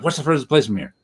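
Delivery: brisk, run-together "What's the furthest place"; fast question. Shown as a demonstration. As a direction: rattle it off as one quick question. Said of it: "it's a fast question".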